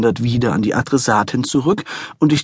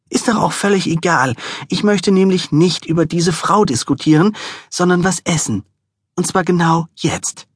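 higher, stubborn insistent voice; stubborn wife; 6 semitones higher than usual. A narrator's reading. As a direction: insist like a sulking child.

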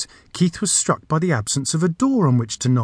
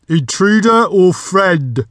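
loud, high, drawn-out door voice; booming challenge; 7 semitones higher, 7 dB louder, slower than usual. A narrator's reading. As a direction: challenge the visitor slowly and forcefully. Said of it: "it continued".

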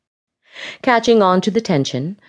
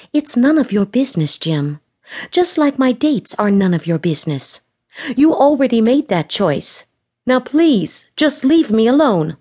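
angry; 6 semitones higher than usual. A narrator's reading. Lauren tells Jim off.

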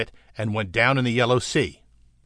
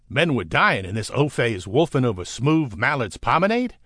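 plain reading voice

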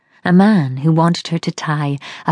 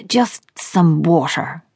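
croaks; slower than usual. Acted. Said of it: "he croaks".

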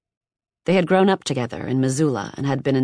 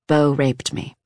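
trembling, unsteady voice; shaky voice; slower than usual. A narrator's reading. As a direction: let the voice shake on each word. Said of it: "in a shaky voice declared".

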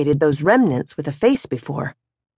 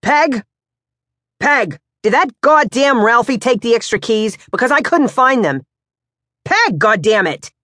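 higher, louder grumbling husband calling through the house; gruff father Pops; 7 semitones higher, 3 dB louder than usual. Acted. Pops hunting the deli keys, calling his wife, annoyed. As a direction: yell for Peg, annoyed.

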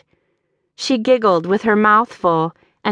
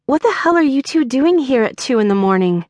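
high, fast, exasperated demand; exasperated question; 5 semitones higher, faster than usual. A narrator's reading. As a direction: rattle off the question, fed up. Demanding answers in the night.

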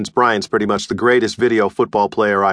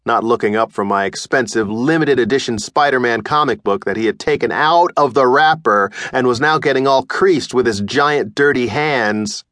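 plain reading voice

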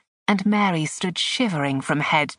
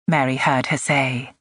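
plain reading voice

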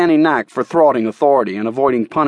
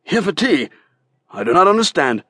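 higher, baffled merchant; a merchant confused by the girl's answer; puzzled merchant; 6 semitones higher than usual. A narrator's reading.

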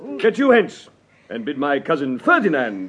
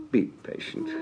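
mock soothing; 13 semitones higher, 11 dB quieter than usual.

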